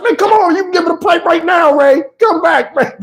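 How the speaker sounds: high shouted plea imitating Terrence Howard; panicked Terrence Howard. Terrence Howard shouting after Ray leaving the house, high plea. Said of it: "Terrence Howard said".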